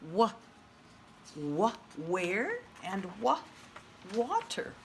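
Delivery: put-on teacher sounding out the W for children. Shown as a demonstration. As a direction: repeat "wa-" to isolate the W. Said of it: "I hear that W sound".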